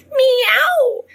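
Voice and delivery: very high, loud warning meow; cat meow. A narrator's reading. Cats warning Harriet, high loud meow. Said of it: "they began to hiss".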